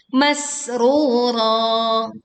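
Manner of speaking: "Masrura" chanted very slowly, thin hissing s; sharp pressed s. Shown as a demonstration. Teacher modelling sin and ra, sharp s. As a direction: recite "Masrura" with thick long ru and ro. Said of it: "ditekan sinnya dengan suara".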